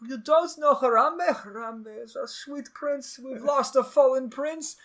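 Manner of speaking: put-on high, slow Russian-Israeli accent. Russian kid lamenting Harambe, high drawn-out Russian accent. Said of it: "his Russian accent trumps the Israeli".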